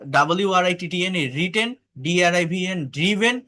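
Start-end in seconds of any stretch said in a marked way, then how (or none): 1.3-1.8 রিটেন with "ri", not "rai"; teacher's model of written as রিটেন; short i. Shown as a demonstration. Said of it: "অনেকে এটা রাইডেন বলে". 2.9-3.3 ড্রিভেন with "dri", not "drai"; teacher's model of driven as ড্রিভেন, pitched up; short i. Shown as a demonstration. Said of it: "ড্রাইভেন বলে".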